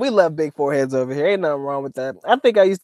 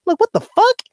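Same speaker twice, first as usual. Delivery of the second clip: very high-pitched disbelief; incredulous; 3 dB louder than usual. Real, not acted. Streamer reacting to his friend's new accent, incredulous. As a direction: squeal it in comic bafflement.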